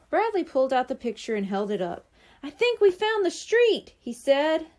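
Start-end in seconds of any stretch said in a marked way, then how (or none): 2.4-3.9 excited discovery. Acted. Bradley finds the right street.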